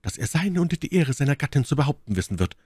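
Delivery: high, urgent lady's voice; Milady, eindringlich klagend. Acted. Story figure Milady speaks. (Mylady, hohe klagende Frauenstimme)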